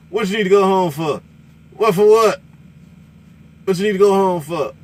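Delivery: high, loud excited sales pitch; imitating the trucker. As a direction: hype it up like the trucker on the radio. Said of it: "he was like".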